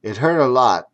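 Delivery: ordinary delivery, nothing marked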